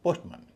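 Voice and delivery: पोस्टमन with मन as a short schwa; reduced "mun" ending. Shown as a demonstration. Teacher giving the correct form, पोस्टमन with reduced मन. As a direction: shorten the ending to मन, not मैन. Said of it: "मैन न होके मन हो जाता है".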